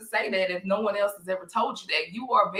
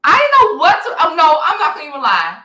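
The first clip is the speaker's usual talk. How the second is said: much higher and louder, bursting; excited outburst; 7 semitones higher, 11 dB louder than usual. Real, not acted. Host gushing to the chef about his tasting, excited burst. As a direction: gush like you can't contain it.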